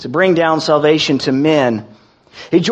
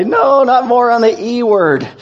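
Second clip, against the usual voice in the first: higher, whiny mock complaint; mock groan; 6 semitones higher than usual. Pastor mimicking a tired congregant, mock whiny groan. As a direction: playfully whine like a weary listener. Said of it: "are like this guy".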